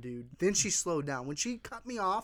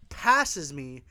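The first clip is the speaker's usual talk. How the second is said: ordinary delivery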